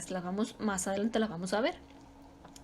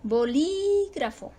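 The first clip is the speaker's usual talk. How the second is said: "Bolígrafo" slow, with LÍ high and long; stress on LÍ; 4 semitones higher, slower than usual. Shown as a demonstration. Teacher giving examples of written accent, stress on LÍ. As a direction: draw out LÍ in "Bolígrafo". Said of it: "ahí cae la sílaba tónica".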